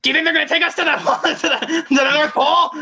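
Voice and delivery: put-on high, loud, giggly child voice; excited kid impression. Friend acts out the train kid for the camera, high and giggly. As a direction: play the wide-eyed kid on the train, breathless with glee. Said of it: "The part where he's all like".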